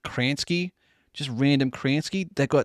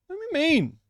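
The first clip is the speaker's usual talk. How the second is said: much higher squeaky "Don't be mean"; squeaky plea; slower than usual. Acted. Pleading with chat.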